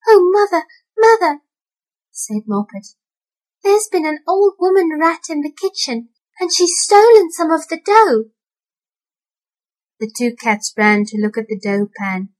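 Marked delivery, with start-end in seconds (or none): 0.1-1.4 high, loud frightened cry; a scared kitten crying for mother. A narrator's reading. Moppet running to her mother, frightened and high. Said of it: "in a terrible fright". 3.6-8.3 high breathless report; frightened kitten. A narrator's reading. Moppet telling mother about the rat, high and scared. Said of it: "in a terrible fright".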